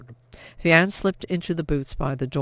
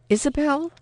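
surprised; 11 semitones higher than usual. A narrator's reading. Surprised to find sister.

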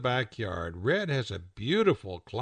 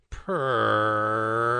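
cat purr; 3 semitones lower than usual. Acted. Cat purring.

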